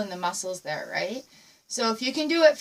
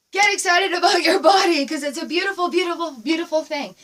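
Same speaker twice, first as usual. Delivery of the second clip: louder, higher, rousing shout; excited cheer; 5 semitones higher, 6 dB louder than usual. Real, not acted. Dance teacher urging class to love their bodies, excited shout. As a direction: fire them up about their bodies.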